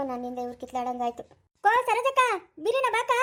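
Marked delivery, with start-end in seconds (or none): 1.6-3.1 calling out. Acted. Calling neighbours to the fight.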